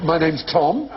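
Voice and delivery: high, TOM rising questioningly; upspeak. Acted. Retelling his introduction in like-speak, mock upspeak. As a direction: lift "Tom" as if asking. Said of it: "he was like".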